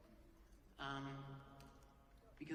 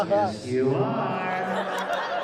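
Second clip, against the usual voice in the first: loud, very slow sung-out reply; teasing inner voice; 16 dB louder, much slower than usual. Retold or imitated. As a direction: drag it out mockingly, half singing. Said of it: "the other voice goes".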